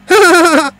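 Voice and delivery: put-on high, loud boyish laugh; a short burst of childish glee. James joining the dragon's laughter at the fire, bright laugh. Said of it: "so did James".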